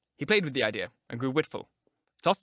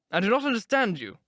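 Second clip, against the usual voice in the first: flustered baronet; 9 semitones higher, 4 dB louder than usual. A narrator's reading. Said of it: "growing rather red".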